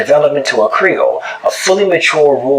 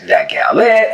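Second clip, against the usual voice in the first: That gal there, there without the d; higher Gullah That gal there; Gullah phrase; 8 semitones higher, slower than usual. Shown as a demonstration. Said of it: "For instance, in the sentences".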